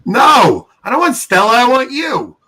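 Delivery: loud, high call up the stairs. Real, not acted. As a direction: shout upstairs to the wife, playful. Calling upstairs to his wife.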